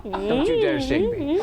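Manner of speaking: laughing. Real, not acted. Being lifted, laughing.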